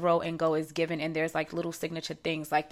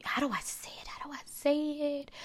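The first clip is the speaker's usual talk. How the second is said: hushed, whispered self-questioning; whispered aside; 9 semitones higher, 7 dB quieter, faster than usual. Real, not acted. Woman whispers to herself, searching for words. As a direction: whisper it quickly to yourself.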